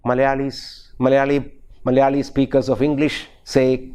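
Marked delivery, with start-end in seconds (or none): none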